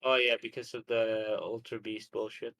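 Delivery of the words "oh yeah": ordinary delivery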